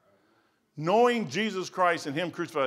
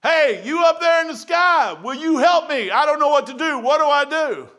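calling out to heaven; 12 semitones higher, 11 dB louder than usual. Retold or imitated. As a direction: shout to the sky for help. Retold crying out to sky.

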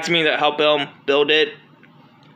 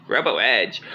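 low, flat robot voice saying "Robo Edge"; 8 semitones lower, 4 dB quieter than usual. Acted. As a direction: announce "Robo Edge" like a machine, low and even. Robot states its own name.

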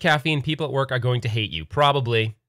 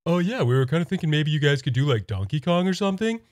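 dopey, hesitant pitchman, slightly raised; Patrick; 3 semitones higher than usual. Pretend. Arcade One Up pitching Nintendo, Patrick-like voice. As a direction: stumble through a timid sales pitch. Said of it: "sounds like Patrick from SpongeBob".

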